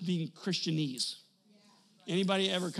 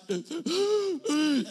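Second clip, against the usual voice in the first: gasp; 9 semitones higher, 5 dB louder than usual. Retold or imitated. Walking in the door.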